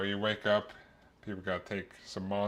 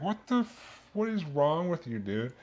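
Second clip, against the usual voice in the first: incredulous disbelief; 6 semitones higher than usual. Retold or imitated. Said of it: "I'm like".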